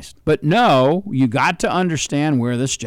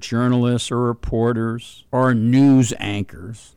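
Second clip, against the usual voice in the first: usual speaking voice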